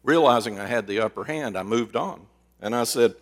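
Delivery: usual speaking voice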